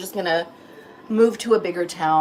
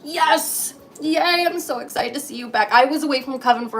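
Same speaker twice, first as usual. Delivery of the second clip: much higher, fast and gushing; excited cheering; 7 semitones higher, 3 dB louder than usual. Real, not acted. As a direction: cheer a friend coming back. Cheering viewer's return.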